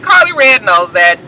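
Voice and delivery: ordinary talk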